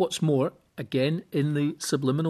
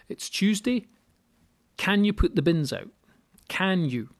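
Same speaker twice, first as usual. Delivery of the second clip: "Can you" pressed hard and raised, each word weighed; stress on Can you; 6 semitones higher than usual. Shown as a demonstration. Speaker replays wife's request, leaning hard on "Can you". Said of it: "Ah, right, stop. Let's rewind there".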